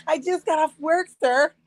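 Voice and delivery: high, put-on innocent plea; innocent victim voice. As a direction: play the sweet victim for the cops. Fake innocent victim to police.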